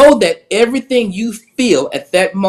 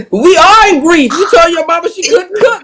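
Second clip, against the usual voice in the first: high, loud, laughing protest; laughing loud and high in mock outrage; laughing outburst; 9 semitones higher, 3 dB louder than usual. Real, not acted. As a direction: crack up while scolding. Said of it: "laughing at grief joke".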